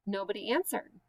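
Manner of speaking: ordinary delivery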